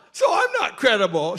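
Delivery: loud mock indignation; offended retort. Speaker challenges coworker who trusts the doctor, indignant. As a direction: throw it back, acting affronted.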